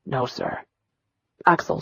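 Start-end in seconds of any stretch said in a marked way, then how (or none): 0.1-0.6 much softer and lower, barely there; timid reply. Acted. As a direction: shrink away as you answer. Boy answers the furious commander.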